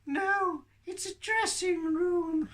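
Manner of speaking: very high, slow puppet voice; puppet Tilly. Acted. Puppet corrects the other puppet, high slow voice. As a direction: correct them in a drawn-out squeak.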